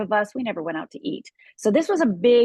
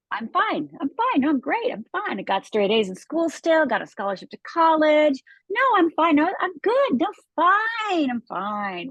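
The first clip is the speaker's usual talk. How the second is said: faking fine; 7 semitones higher than usual. Pretend. Telling everyone she is fine while hiding pain, forced cheerful brush-off. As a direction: chirp it like nothing is wrong. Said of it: "On the outside".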